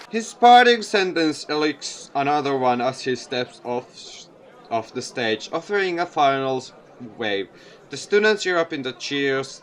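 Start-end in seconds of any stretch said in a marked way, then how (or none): none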